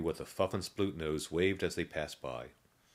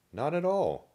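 put-on old zombie voice; 12 semitones higher, 6 dB louder than usual. A narrator's reading. Old zombie answers Julie.

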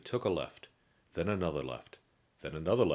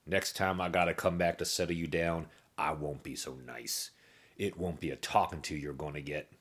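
1 gruff guard threat. A narrator's reading.

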